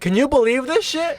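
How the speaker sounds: high-pitched mock incredulity. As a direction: imitate a friend indignantly retelling it. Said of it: "and be like".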